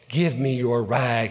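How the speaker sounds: low, slow and tender; with pity. A narrator's reading. Rag man offering the bleeding child his own cloth, low and tender. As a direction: speak slowly and low, full of pity. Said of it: "looked upon this child with pity".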